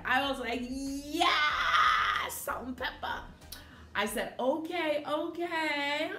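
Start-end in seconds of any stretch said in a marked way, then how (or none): 0.6-3.2 high, drawn-out retold yes; excited cheer. Fan recalling [name]'s cameo, high excited cheer. 4.4-6.1 tag. Retold or imitated. Retelling pleased reaction.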